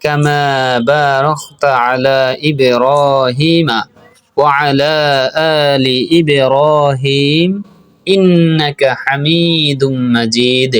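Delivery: slow, measured chant with long held vowels; unhurried melodic teaching recitation; Arabic prayer recitation. Shown as a demonstration. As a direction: recite slowly, drawing out each long syllable.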